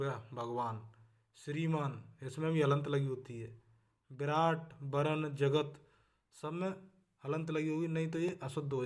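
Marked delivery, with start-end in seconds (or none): none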